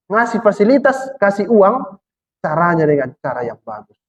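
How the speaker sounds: normal talking voice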